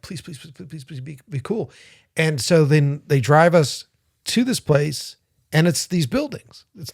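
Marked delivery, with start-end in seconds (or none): 0.0-1.7 hushed, repeated begging under the breath; anxious pleading. Retold or imitated. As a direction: plead very softly, as if to yourself. Silent pleading in a scary car.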